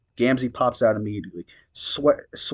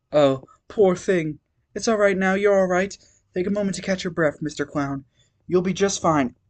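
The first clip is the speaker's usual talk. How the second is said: gentle comforting voice; 8 semitones higher than usual. Acted. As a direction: coo over him like a nurse. Calliope comforting Gamzee.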